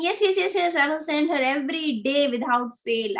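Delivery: high, eager imitated promise; lightly acted frantic yeses, settling as it goes; anxious agreeing. Jatila promising the snake to send her, mock anxious agreeing.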